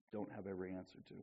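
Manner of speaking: ordinary delivery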